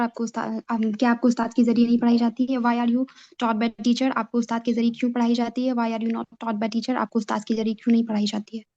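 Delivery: normal talking voice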